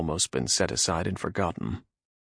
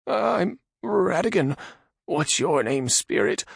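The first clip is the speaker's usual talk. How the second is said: high nervous man voice; frightened Radagon; 13 semitones higher, 4 dB louder, slower than usual. Acted. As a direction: ask in timid fear. Radagon timid.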